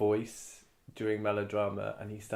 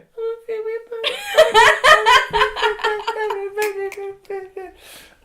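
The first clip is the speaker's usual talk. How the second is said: high, loud mocking gibberish; mocking voice noise; 15 dB louder than usual. Teacher parodying the actor's voice, loud and high. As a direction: parody his voice back at him. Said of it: "He'd go".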